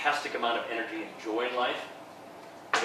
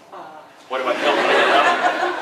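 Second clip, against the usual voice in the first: dreamy sigh; 7 dB louder than usual. Retold or imitated. Describing the butterfly feeling of love.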